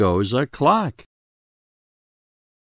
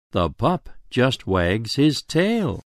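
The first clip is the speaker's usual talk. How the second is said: ordinary delivery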